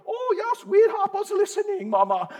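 high, sugary mock reassurance to mom; mocking sweet talk. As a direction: brush her off in a high, fake-sweet voice. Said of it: "I go".

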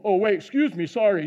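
usual speaking voice

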